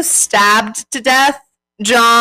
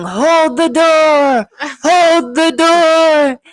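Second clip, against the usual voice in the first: high, slow, drawn-out mock yell; 7 semitones higher, 4 dB louder, much slower than usual. Retelling a TV scene, imitating a man yelling, high and slow. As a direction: cry it out high and slow, half acting it. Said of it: "he's yelling".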